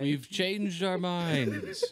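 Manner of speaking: high, drawn-out mock retreat; slow, high mock-coward announcement; cowardly soldiers backing out. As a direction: stretch it out high, playing the turncoats. Voicing the retreating soldiers, joking.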